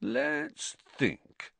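high, soft, exaggerated pondering; mock puzzlement. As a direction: pretend to puzzle it out. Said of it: "said Ron in mock puzzlement".

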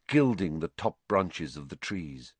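usual speaking voice

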